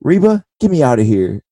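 high, sassy mock scolding; imitating Britt Baker. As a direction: scold him in a high pouty voice. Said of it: "she goes".

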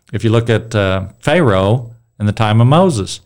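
ordinary talk

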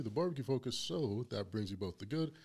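ordinary talk, nothing marked

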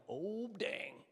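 soft, drawn-out mock dread; quietly sinking reaction; dismayed aside. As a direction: say it under your breath as if caught out. Dreading the confrontation with her.

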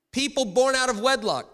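high, very loud mock questioner; hammed-up high-pitched challenge; shouted crowd question. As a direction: yell it out from the back of the crowd. Imagined crowd Q and A heckler.

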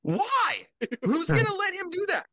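very high, squeaky incredulous cry. Retold or imitated. Podcaster mocking his own shocked reaction, high incredulous squeak. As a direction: shriek the question in disbelief. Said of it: "I'm like".

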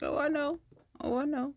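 high, insistent agreeing; knowing agreement. Retold or imitated. Telling the man she already knows, high knowing agreement. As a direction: agree readily in a lifted pitch. Retelling her quick agreement with him.

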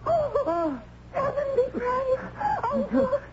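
high, crying relief; tearful reunion. Acted. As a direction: sob out his name with relief. Tearful reunion with her uncle.

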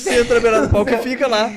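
talking through laughter; laughing. Real, not acted. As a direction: laugh as you say it. Laugh heard on line. (falando rindo)

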